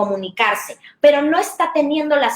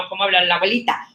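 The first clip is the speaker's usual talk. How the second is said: lightly voiced little grandmother, lower; a hint of a sweet old granny; mild granny voice for kids; 5 semitones lower, faster than usual. Shown as a demonstration. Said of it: "cambios de código o cambios de entonación".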